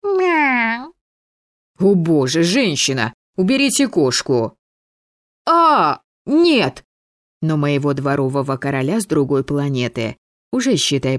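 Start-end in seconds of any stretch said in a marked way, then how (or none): 0.0-0.9 high, small cat mew; coquettish mew. A narrator's reading. Same little cat mews again. 1.8-6.8 raised, rising outcry; horrified exclamation. A narrator's reading. Committee exclaims over the cat.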